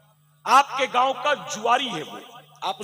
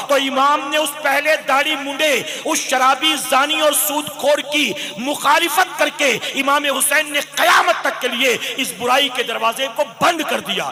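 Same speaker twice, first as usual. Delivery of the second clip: louder, driving preacher's cry to the crowd; fiery sermon; 5 dB louder than usual. Acted. Preacher challenging the congregation, loud and rising. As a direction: preach it hard, building the fire.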